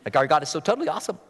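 put-on fast, gushing valley girl. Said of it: "not said like a valley girl".

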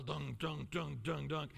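low thudding dunks, one per step; stumbling sound effect. Retold or imitated. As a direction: mimic each clumsy footstep of a slow fall. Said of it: "It's like a slow motion stumble".

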